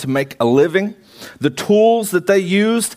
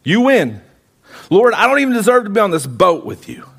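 higher, emphatic acted surrender; overwhelmed surrender; 4 semitones higher, 3 dB louder than usual. Simon on his knees to Jesus, overwhelmed surrender. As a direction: give in with rising intensity, pitched up.

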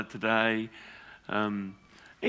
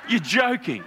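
higher, laughing "You're joking"; surprised disbelief; 6 semitones higher, slower than usual. Retold or imitated. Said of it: "I quote myself".

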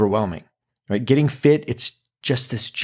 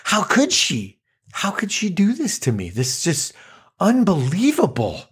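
higher, hammed-up wounded disbelief; mock outrage; 7 semitones higher than usual. Wounded by a friend's betrayal, mock outrage. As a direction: play the betrayed friend, overdone.

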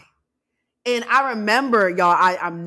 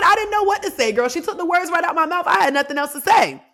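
ordinary talk

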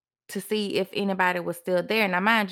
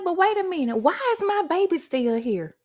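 higher, startled retold thought; sudden alarmed realization; 8 semitones higher than usual. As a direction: catch yourself mid-panic, voice jumping up. Realizing baby was not taken.

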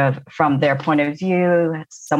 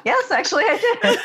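high, giggly retold answer; laughing reply. Guest retells admitting they really practiced, laughing. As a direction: retell it through bubbling laughter.

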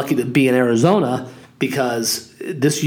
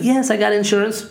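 higher-pitched imitation of a woman; retold lady; 7 semitones higher than usual. Lady answers the car owner about insurance, imitated woman. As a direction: lightly mimic the lady, pitched up. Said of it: "She's like".

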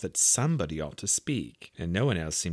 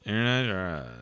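shivering. A narrator's reading. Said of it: "said the Lory with a shiver".

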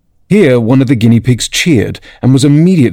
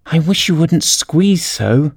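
slow, higher-pitched little creature; complaining Dormouse; 6 semitones higher, slower than usual. A narrator's reading. The Dormouse complains to Alice beside it, slow and put-upon. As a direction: drag out the complaint in a high voice.

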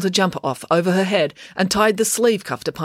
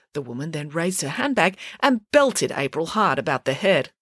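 plain reading voice

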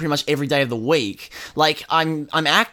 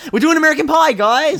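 loud, high excited reveal; 12 semitones higher, 7 dB louder than usual. Real, not acted. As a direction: burst out with the surprise. Surprise announcement of next franchise.

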